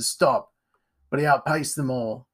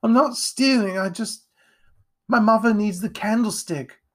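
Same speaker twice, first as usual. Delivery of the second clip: high, tearful protest; crying boy; 9 semitones higher, 4 dB louder than usual. A narrator's reading. Jack defends himself to Edgar, crying. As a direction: plead innocence through tears, pitched high.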